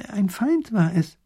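high, slow, drawn-out declaration; slow, high professor's pronouncement; grave revelation. A narrator's reading. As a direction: reveal a culprit slowly and weightily. Professor reveals his theory.

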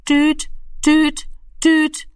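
high, beeping imitation of a dial tone; phone dial tone. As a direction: beep it out like an old telephone. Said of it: "erschreckte ihn schon nicht mehr".

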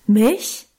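startled, rising cry of surprise; mild yelp of disbelief; astonished outcry. A narrator's reading. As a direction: blurt it out, amazed and a little shocked. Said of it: "schrie Guste auf".

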